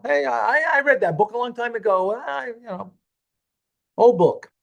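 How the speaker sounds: dismissive. Acted. Teacher voicing someone shrugging off an old book, dismissive. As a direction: wave it away as old news.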